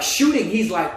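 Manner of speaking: ordinary delivery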